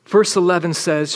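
usual speaking voice

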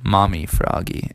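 usual speaking voice

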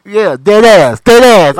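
loud hammed-up New York slang; New York accent. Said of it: "fake New York accent".

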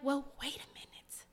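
hushed aside, under the breath; whisper. Retold or imitated. Whispering to herself about the fast. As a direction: whisper it like a secret.

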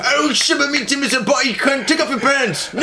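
very high, hammed-up British gibberish; mock British accent. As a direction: exaggerate a silly high-voiced Brit. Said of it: "English and Scottish listeners".

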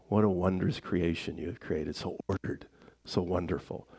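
soft, partly whispered marveling; hushed wonder. Retold or imitated. Scientist praising creation, hushed reverent wonder. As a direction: murmur it in reverent awe.

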